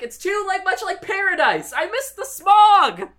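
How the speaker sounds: high-pitched homesick whine; mock complaining. Producer misses the city smog, whining.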